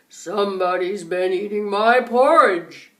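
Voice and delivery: deep, booming papa bear voice; loud low growl of a big bear. A narrator's reading. Papa bear scolds the family over his porridge, deep and loud. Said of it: "the papa bear said".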